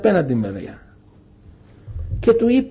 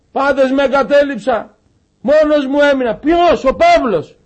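high, raised preaching voice, urgent; 7 semitones higher, 3 dB louder than usual. Acted. Preacher urging the congregation back to its purpose, raised and urgent. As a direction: exhort the brothers with rising urgency.

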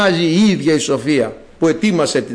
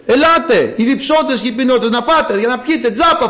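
raised, higher crier's call; 3 semitones higher than usual. Acted. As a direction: call everyone in like a street crier. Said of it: "φωνάζει η σοφία". (δυνατό κάλεσμα, σαν τελάλης)

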